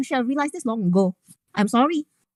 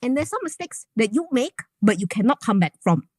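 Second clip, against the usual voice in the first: ordinary talk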